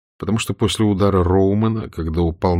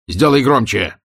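loud, higher sudden cry; sudden shout; 4 semitones higher, 4 dB louder than usual. Acted. Roman suddenly shouts to turn the radio up. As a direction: blurt it out urgently. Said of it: "вдруг крикнул Роуман".

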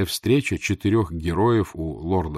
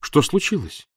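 higher, urgent exclamation; exclaiming; 6 semitones higher than usual. A narrator's reading. Rejected lover asks his sweetheart what happened, exclaiming. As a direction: exclaim it in alarm. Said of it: "воскликнул я".

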